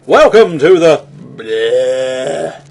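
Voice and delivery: slow zombie groan on bleh; zombie moan. Acted. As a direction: drag it out like a zombie. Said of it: "I'm doing my finest impression of a zombie".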